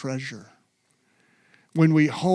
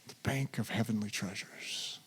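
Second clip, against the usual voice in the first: whisper; 6 semitones lower, 12 dB quieter than usual. Shown as a demonstration. End of modeled prayer.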